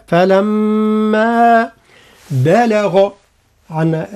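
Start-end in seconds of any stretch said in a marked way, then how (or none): 0.1-1.7 "فلما" very slowly, a nasal hum on the م then a long final ا; ghunna and madd. Shown as a demonstration. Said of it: "هكذا". ("فلما" بالغنة والمد)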